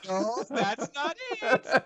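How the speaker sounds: very high, loud, drawn out; mock-sweet squealed correction; parody kids' show host. Dora correcting viewers, high slow cartoon voice.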